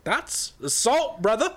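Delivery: high, slow, hammed-up protest; mock outrage. Dad answers the yelling neighbour, mock outrage. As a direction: draw out the indignant comeback way up high.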